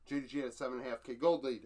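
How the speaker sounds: rushed, run-together delivery; hurried stat read, words crammed together; really fast. Real, not acted. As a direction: rattle it off quickly. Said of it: "I said that really fast".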